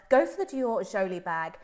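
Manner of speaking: ordinary talk, nothing marked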